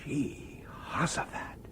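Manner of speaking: breathless, hushed gasp of surprise; awed under-the-breath oath; startled whisper. Acted. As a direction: whisper it, caught off guard by the light. Startled by the new window.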